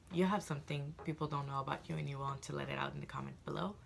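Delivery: normal talking voice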